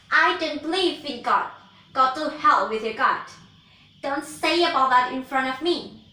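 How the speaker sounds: loud furious witch voice; angry witch. Acted. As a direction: rage like a wicked witch. Witch rages at Dewi Arum.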